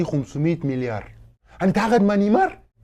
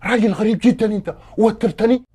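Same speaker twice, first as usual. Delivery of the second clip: raised, loud complaint; exasperated and higher-pitched; rattled irritation; 4 semitones higher, 5 dB louder than usual. Acted. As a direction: complain loudly, nerves frayed. Irritated, says guest rattles him.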